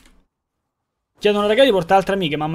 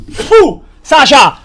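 high, loud goading shout; loud call; 8 semitones higher, 12 dB louder than usual. Acted. Streamer shouting at a friend to step up, loud and high. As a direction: yell at him to man up.

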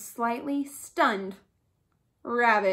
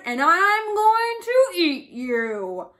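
loud, higher, slow roar; 6 semitones higher, 7 dB louder, slower than usual. A narrator's reading. Lion threatening captured rabbit, slow roar. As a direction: draw the threat out loudly. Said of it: "he roared".

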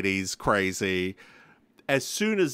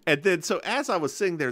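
ordinary delivery

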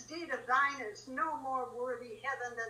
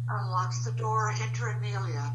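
low, flat reading of the stage direction; deep aside reading the stage direction; 4 semitones lower, 3 dB louder than usual. A narrator's reading. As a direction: read it out like a script note.

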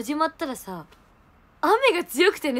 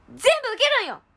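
raised, high indignant cry; too loud; 10 semitones higher, faster than usual. Real, not acted. Telling her friend loudly how she took all the rain. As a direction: protest at full volume. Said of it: "声がでかい。".

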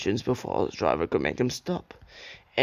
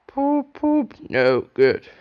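grumbling; 5 dB louder, slower than usual. A narrator's reading. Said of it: "grumbled Gordon".

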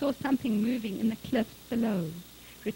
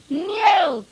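loud, high drawn-out call of a name; shouted; 8 semitones higher, 12 dB louder than usual. A narrator's reading. Father calling his lost son, shouted. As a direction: holler across the mountain. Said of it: "he shouted".

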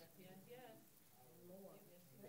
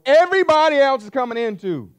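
loud and higher, hammering it home; preaching shout; 10 semitones higher, 12 dB louder than usual. Shown as a demonstration. Preacher shouting to the congregation about fearful people. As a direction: belt it out like a preacher. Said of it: "Here's how you can tell".

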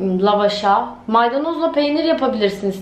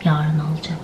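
normal talking voice

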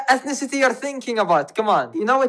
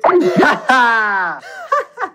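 cackling laugh; 3 semitones higher than usual. Real, not acted. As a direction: burst of cackling. Laughs after his airstrike bit.

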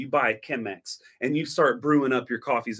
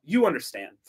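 normal talking voice

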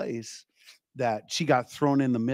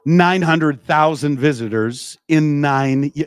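slow and loud, every word of "Nine hundred thousand visitors" hit; slow emphatic preaching; 7 dB louder, slower than usual. Shown as a demonstration. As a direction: drive the number home to the congregation. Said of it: "preaching emphasis".

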